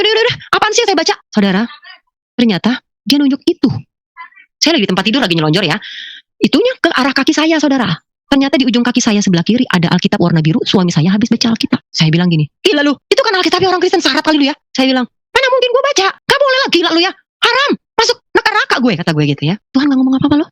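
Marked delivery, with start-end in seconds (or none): 0.0-1.1 high, rapid-fire exasperation; impatient brush-off. Retold or imitated. Woman giving in to the persistent voice, impatient. 12.7-18.9 high-pitched, scandalised protest; indignant refusal. Retold or imitated. Woman refusing to read a forbidden book, indignant.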